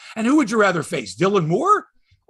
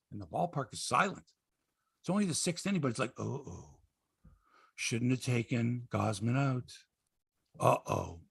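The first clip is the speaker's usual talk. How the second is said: much softer, low and deflated; hushed dread; 7 semitones lower, 11 dB quieter than usual. Retold or imitated. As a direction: drop to a stunned near-murmur. Voices the silenced crowd's dread.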